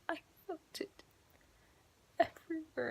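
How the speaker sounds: soft, high, breaking mock sob; crying. Will crying to his mom, soft tearful confession. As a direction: imitate a boy tearfully confiding, barely voiced. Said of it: "to his mom crying".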